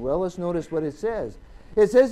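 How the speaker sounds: normal talking voice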